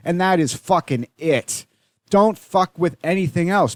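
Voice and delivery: real exasperated ranting; angry rant. Real, not acted. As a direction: vent real frustration, voice climbing. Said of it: "I'm getting angry".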